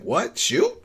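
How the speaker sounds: high, rising puzzled questions; mock confusion. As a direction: imitate baffled people double-checking an order. Acts out the group's confused reaction.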